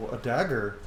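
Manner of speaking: usual speaking voice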